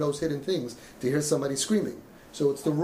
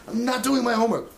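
a higher, louder defiant refusal; defiant kid; 8 semitones higher, 4 dB louder than usual. Retold or imitated. Son refusing his parents, in a higher, louder defiant voice. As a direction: throw the refusal back at the parents.

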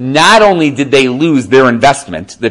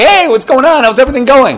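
cheery greeting pitched way up; chirpy and happy; 13 semitones higher, 4 dB louder than usual. Retold or imitated. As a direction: greet him breezily as if nothing went wrong. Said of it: "super chirpy and, like, happy".

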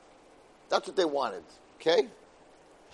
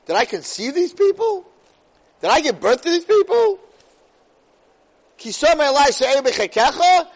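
much higher and louder, exasperated cry; exasperated outburst; 8 semitones higher, 6 dB louder than usual. Acted. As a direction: throw it up at God in disbelief. Moshe's outburst voiced.

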